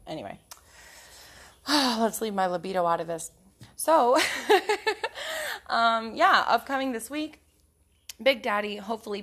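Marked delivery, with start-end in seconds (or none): 4.2-5.6 a sudden squeal of laughter, way up high. Real, not acted. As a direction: burst into a shrill giggle at your own joke. Giggling at her own joke.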